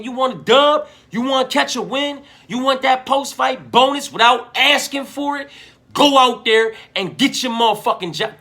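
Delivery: real heated pep talk, voice climbing; worked-up coaching rant. Real, not acted. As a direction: fire him up like a cornerman yelling advice. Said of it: "I got worked up over that one".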